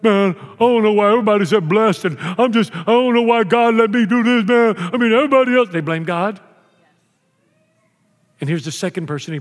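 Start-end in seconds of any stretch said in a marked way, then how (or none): 0.0-5.7 lightly voiced griping complainer; mock complainer. Imitating people who blame God, a mild mock gripe. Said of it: "You meet people like that".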